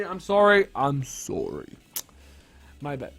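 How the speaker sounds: ordinary delivery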